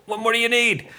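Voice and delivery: high, exclaimed rhetorical question; enthusiastic. Real, not acted. Hyping the cast to listeners, high and enthusiastic. Rousing end to cast praise.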